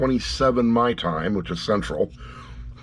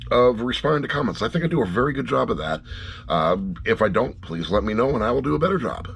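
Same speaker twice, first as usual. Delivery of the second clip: normal talking voice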